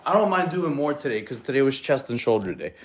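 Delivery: ordinary talk, nothing marked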